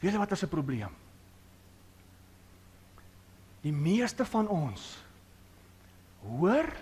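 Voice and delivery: low, soft, intimate preaching; hushed preaching. Real, not acted. Preacher quietly searching the listeners' hearts, hushed. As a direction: draw them in with a near-hush.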